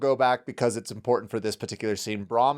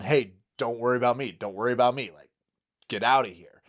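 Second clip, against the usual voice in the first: ordinary delivery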